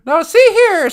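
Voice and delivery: put-on high, loud old-timey indignation; mock 1940s scolding; imitating wartime elders. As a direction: protest like a hammed-up old-timer. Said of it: "World War Two elders' way they talked".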